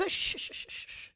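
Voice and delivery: unvoiced hushing shh; soft fading whispered shh; whispered shush. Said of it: "I was like".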